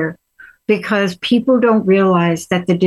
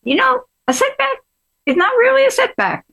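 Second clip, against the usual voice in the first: bad Southern accent; 9 semitones higher than usual. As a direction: put on a hammy Southern accent, imitating mom. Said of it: "my bad Southern accent".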